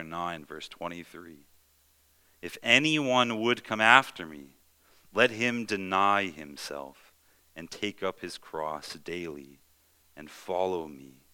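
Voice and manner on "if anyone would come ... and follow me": loud then slowing solemn reading; scripture reading. Acted. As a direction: proclaim it, then slow right down. Reads Luke chapter nine.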